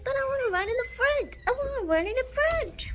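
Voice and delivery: high, fast whiny dog voice; voicing the dog. Owner voicing her dog's wish to run ahead, high whiny. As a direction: whine it like an eager puppy. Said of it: "He's like".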